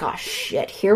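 hushed, low mutter; acted near-whispered grumble; retold dread aside. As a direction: say it under the breath.

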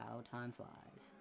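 wistful murmur. Acted. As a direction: let it fade out, lost in memory. Old driver muses on past game.

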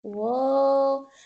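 ordinary talk, nothing marked

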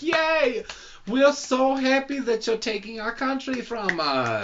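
high, gushing mock Austrian cheer; hammed-up Austrian accent, celebrating in a high voice. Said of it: "the Austrians throw a parade".

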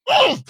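sharp high yelp of pain. Acted. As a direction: yelp as your ear is pinched. Ear pinched.

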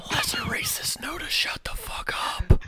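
hissed whisper, high and breathy; menacing whisper. Acted. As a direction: whisper it as a threat. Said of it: "look this thing dead in the face and say".

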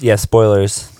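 ordinary delivery

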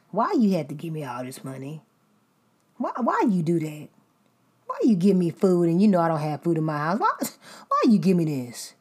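loud, indignant ungrateful friend; an ungrateful friend ranting over a kind gift, loud and aghast; mock ungrateful complainer. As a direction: complain about a gift, hammed up. Ungrateful receiver voiced.

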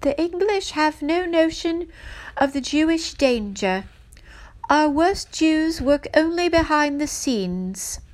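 high, loud mock English accent; exaggerated English accent, ringing out like a rallying speech; bad English accent. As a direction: put on a hammy English accent, pitched well up. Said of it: "I'll do my bad English accent".

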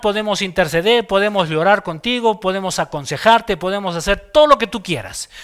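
usual speaking voice

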